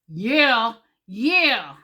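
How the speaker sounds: loud, punchy celebratory yeahs; triumphant cheer. Retold or imitated. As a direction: crow over a small victory. Cheering own first-try success.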